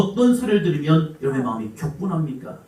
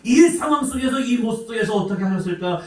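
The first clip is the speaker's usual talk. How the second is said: preaching at full force; 8 semitones higher than usual. Acted. Pastor praying aloud over congregation, fervent shout. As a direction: rebuke the devil with full force.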